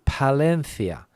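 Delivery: Palencia with th for the C, "Palenthia"; Castilian th. Shown as a demonstration. Teacher lists places around the country, Palencia with th. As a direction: say Palencia's C like th in think. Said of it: "The th is exactly the same sound".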